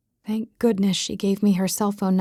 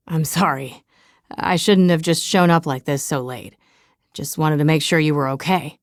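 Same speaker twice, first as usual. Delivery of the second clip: a hint of a lower, sheepish man's voice; lightly voiced apologetic Brent; 5 semitones lower than usual. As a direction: apologise gently in a slightly lower voice.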